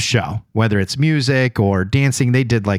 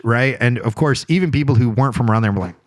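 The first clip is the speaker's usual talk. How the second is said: ordinary delivery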